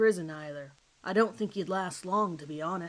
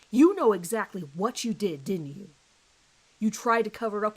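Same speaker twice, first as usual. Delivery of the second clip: loud, forceful accusing voice; hard accusation; 6 dB louder than usual. Acted. Detective accusing the suspect to his face, loud and hard. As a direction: nail him with it.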